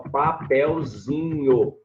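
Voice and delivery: "papelzinho" stretched out slowly in syllables. Shown as a demonstration. Teacher showing students the diminutive, slow syllable by syllable. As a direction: draw out each syllable of "papelzinho".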